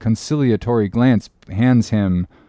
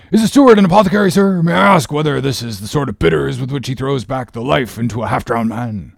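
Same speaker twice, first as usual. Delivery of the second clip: outraged sailor. Acted. Stubb outraged at steward.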